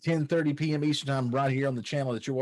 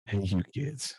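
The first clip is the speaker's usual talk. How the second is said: put-on old man voice; 5 semitones lower, 3 dB quieter than usual. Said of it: "Here, I'll say it in a".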